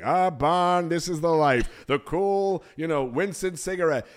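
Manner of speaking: loud, relaxed cartoon caveman; Fred Flintstone voice. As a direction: lean back and savor it loudly. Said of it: "and he's like".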